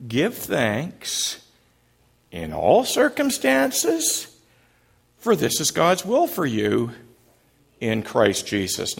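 whole verse run through with shifting tones, a high question in the middle; groups recited. Shown as a demonstration. Pastor modelling the four group parts in a row. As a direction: run each group's manner in turn. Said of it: "you've got it".

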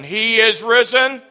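like a comeback cheer at a ball game; with conviction. Real, not acted. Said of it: "with conviction".

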